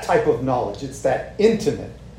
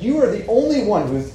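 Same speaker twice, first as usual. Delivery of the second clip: earnest Peter; 5 semitones higher than usual. Retold or imitated. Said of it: "He's like".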